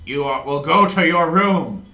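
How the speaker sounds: booming, slow imperial ultimatum; villain king. Acted. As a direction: talk down to your son like a tyrant. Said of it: "he's like".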